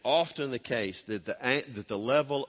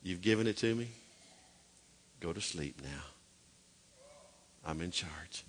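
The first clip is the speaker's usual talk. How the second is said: lower, softer, gentle divine voice; God soothing a restless believer, lower and softer; voicing God; 6 semitones lower, 8 dB quieter than usual. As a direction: speak as a calming God. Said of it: "he says".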